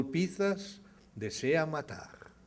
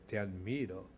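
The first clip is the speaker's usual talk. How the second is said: softer, lower, distant reply; faint echo; 4 semitones lower, 6 dB quieter than usual. A narrator's reading. Mountain answers the father, faint distant echo. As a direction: let it come back faint like an echo. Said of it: "la montaña responde".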